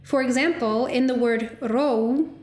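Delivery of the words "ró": ró with the O gliding slowly towards an U; Icelandic diphthong. Shown as a demonstration. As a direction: draw out ró, moving from O to U. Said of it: "the O moves towards an U vowel".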